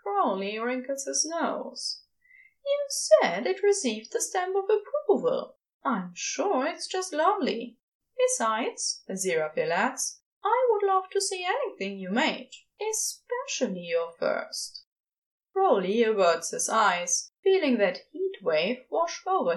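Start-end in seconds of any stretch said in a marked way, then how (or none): 2.6-9.0 higher, coaxing angel voice; Aziraphale. A narrator's reading. Angel coaxing Crowley to show his first creation, higher and eager. 10.4-14.8 higher, coaxing angel voice; Aziraphale. A narrator's reading. Angel coaxing Crowley to show his first creation, higher and eager.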